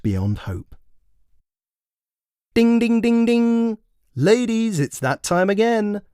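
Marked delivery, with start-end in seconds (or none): 2.5-6.0 bright, much higher, louder host call; speed dating host. Acted. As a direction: ring it out like a cheery event host calling time. Event host rings time bell.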